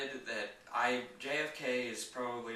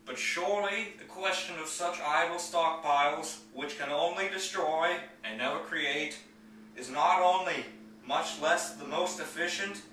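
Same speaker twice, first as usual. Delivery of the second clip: raised, ringing presidential oratory in measured phrases; higher, louder, declamatory podium delivery; JFK impersonation; 3 semitones higher, 5 dB louder than usual. Acted. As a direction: give a famous speech in an imitated statesman's voice, loud and emphatic, pausing between phrases. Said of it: "going to do my J F K impersonation".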